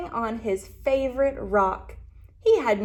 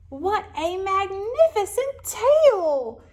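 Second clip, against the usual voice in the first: much higher, loud and slow wonder; exclaiming; 9 semitones higher, 6 dB louder, slower than usual. Acted. Lizard marvels at a tail on a branch, high and loud. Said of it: "exclaimed little lizard".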